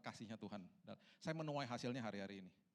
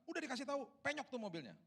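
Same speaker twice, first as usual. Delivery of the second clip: high, loud outburst of reproach; acted angry scolding; scolding parent; 6 dB louder than usual. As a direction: snap at the kid like an exasperated parent. Typical angry parent's reaction acted out.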